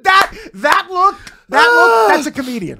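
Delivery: high, loud emphatic shout; excited exclamation. Real, not acted. Comic summing up the look they shared, excited shout. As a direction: hammer it out as the payoff.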